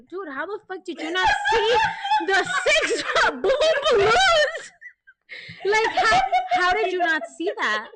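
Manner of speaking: high, loud disbelief breaking into laughter; laughing exasperation, hammed up; incredulous outburst. Incredulous at husband missing balloons.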